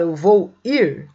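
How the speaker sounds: slow, lean on "ir"; slow wrong example. Shown as a demonstration. Teacher to students, slowly stressing the doubled verb. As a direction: stretch "eu vou ir" to show the doubled verb. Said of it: "Quando eu falo".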